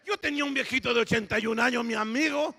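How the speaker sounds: normal talking voice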